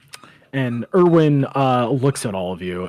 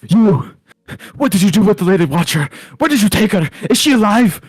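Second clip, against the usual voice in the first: high, loud, frantic accusation; loud, high-pitched demanding shout; furious yell; 13 semitones higher, 9 dB louder than usual. Acted. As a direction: yell across the bar in outrage. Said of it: "yells out".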